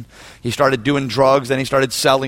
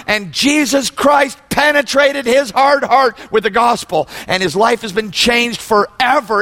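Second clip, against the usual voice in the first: high, fervent sermon delivery; preaching at full force; 7 semitones higher than usual. Real, not acted. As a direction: preach it to the whole room.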